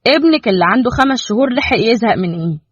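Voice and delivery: plain reading voice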